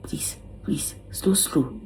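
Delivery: soft breathy begging; hushed frightened pleading; pleading whisper. Retold or imitated. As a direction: whisper your plea, scared. Pleading during procedure.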